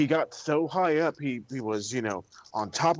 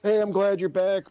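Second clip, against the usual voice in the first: high, louder fake-bright hey; mock cheery hello; 6 semitones higher, 4 dB louder than usual. Imitating a bland welcome back, cheerily. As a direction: greet her with put-on cheer. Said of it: "I'm just like".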